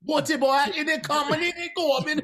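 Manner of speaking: loud, high-pitched mocking impression. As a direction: push it up high and loud as a caricature. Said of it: "Chet is like".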